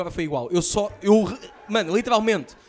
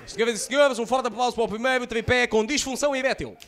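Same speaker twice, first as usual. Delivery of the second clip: louder host announcement; mock announcement; 5 semitones higher, 4 dB louder than usual. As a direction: announce it to the room.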